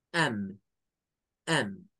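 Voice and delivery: I'm said as am with a Spanish a; contraction am. Shown as a demonstration. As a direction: say am the way teachers teach it. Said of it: "como am".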